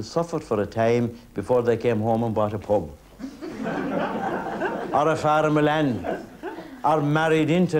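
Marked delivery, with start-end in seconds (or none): 3.2-4.9 quick high laugh. Real, not acted. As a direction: laugh at your own joke. Laughs at pub joke.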